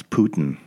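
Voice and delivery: imitating poutine said like Putin; poutine mispronounced as POO-tin. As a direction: hit "POO" and swallow the ending of poutine. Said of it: "she called it Putin".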